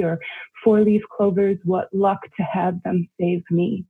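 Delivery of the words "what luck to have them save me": ordinary delivery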